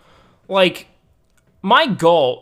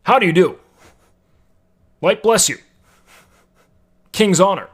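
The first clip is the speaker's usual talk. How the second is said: Stormwind guard; 3 semitones higher, 6 dB louder than usual. Retold or imitated. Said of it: "sounds like a guard from Stormwind".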